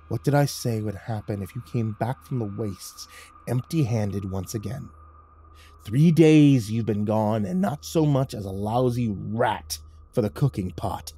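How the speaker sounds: mock patience swelling into menace; menacing gang leader. Leader berating Michael for returning empty-handed, menacing.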